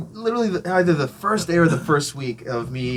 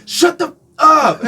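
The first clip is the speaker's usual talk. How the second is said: high, loud imitation of an irate heckler; slow, high retold bellow; mock angry shout; 8 dB louder, slower than usual. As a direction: yell it like an angry man across a park. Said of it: "he's just shouting".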